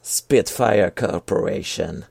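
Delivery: slowly, "Spitfire Corporation" in a careful English accent. Shown as a demonstration. Co-host asks him to say the name, in an English accent. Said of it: "est-ce que tu peux me dire Spitfire Corporation".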